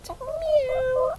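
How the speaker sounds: a high, soft kitty meow; a high little meow for the children; cat meow. Acted.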